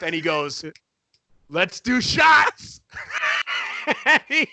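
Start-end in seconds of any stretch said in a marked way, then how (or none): 1.5-2.8 high, loud, slowly drawn-out cheer; hammed-up party call. Dennis calling the whole party to do shots, loud and drawn out. 2.8-4.0 high, airless burst of laughter; a real shriek of laughter. Real, not acted. Laughing at Dennis's demand. 4.0-4.5 high, loud, laughing while talking; laughing through words. Real, not acted. Teller still laughing, telling the co-host about the finger swing.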